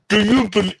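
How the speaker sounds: ordinary talk, nothing marked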